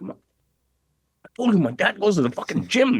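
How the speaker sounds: insist, annoyed they keep laughing; exasperated child repeating himself; irked insistence. Retold or imitated. Irked son insists a third time.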